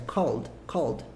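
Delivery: one-beat "called", D straight after the L; correct pronunciation. Shown as a demonstration. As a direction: repeat "called" with a silent E. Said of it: "Fala assim que tá tudo certo".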